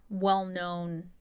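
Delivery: ordinary talk, nothing marked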